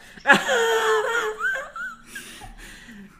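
high, loud laughing near-sob; laughing cry. Real, not acted. Host overcome with emotion, laughing and almost crying. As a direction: laugh through tears. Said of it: "don't cry, [name], don't cry".

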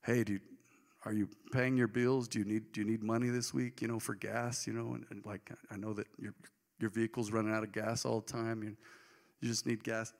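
mock fatherly check-in; hint of a fussing father; imitating dad. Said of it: "my dad called me and go".

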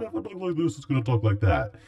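loud, very high start sliding downward; falling siren-like effect voice; talk like this. Shown as a demonstration. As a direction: begin way up high and glide down, staying loud.

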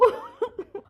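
high, soft sob; tearful sob, overcome; crying. Acted. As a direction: break down sobbing. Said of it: "عم تصح وتبكي".

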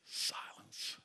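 breathy whisper, barely there; whispered silence. Real, not acted. Preacher letting the rebuke sink in, whispered awe. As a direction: drop to a hush.

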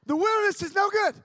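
loud high proclamation; emphatic declaration. Shown as a demonstration. Preaching to the youth camp, loud declaration. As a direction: proclaim it to the back row. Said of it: "repeated slogan, first loud".

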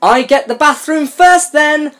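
high, slow, whiny sibling reply; hammed-up teen character. Voicing teen character's grudging reply.

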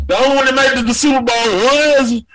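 loud laughing blurt; half laughing, half shouting it out. Real, not acted.

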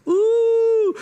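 high, loud, held "ooh"; delighted "ooh". Acted. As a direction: savour it with a long high ooh. Relishing food and hospitality.

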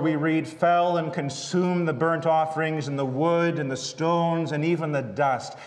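usual speaking voice

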